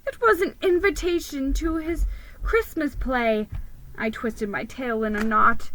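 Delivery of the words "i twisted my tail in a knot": plain reading voice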